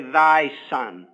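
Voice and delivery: low, pointed "thy son"; sarcastic. Shown as a demonstration. Preacher showing the older brother's jibe at dad, sarcastic. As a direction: drop the pitch and lean scornfully on "thy". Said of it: "I think he said it sarcastically".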